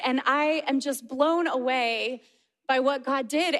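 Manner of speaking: normal talking voice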